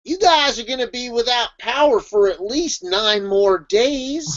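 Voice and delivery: slow Texas drawl; Texas accent. Acted. Warning of nine days without power, Texas accent. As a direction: drag it out with a Texas twang. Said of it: "I had to use my Texas accent for that".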